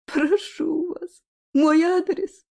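high, sobbing plea; crying on the phone. Acted. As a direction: beg through sobs, voice rising. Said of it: "В трубке были слышны всхлипывания". (плачущая мольба по телефону)

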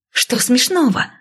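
high, indignant squeal; shriek. A narrator's reading. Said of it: "взвизгнула я". (возмущённый высокий взвизг)

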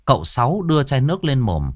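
usual speaking voice

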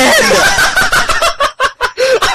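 high, loud giggle shriek; shrill squealing laugh. Acted.

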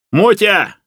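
high, loud shout of a name; shouted call. A narrator's reading. Master shouts down to his servant, shouted call. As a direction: holler down into the pit. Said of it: "крикнул ему Алексей".

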